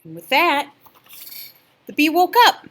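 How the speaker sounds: loud excited narration; bee wakes. A narrator's reading. Reading the story climax, loud and excited.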